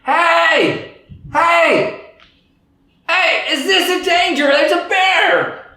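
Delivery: high, loud repeated yell. Acted. Unconscious brain yelling at the frontal lobe about a bear. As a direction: shout the warning at full volume. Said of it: "yelling at the top of his lungs".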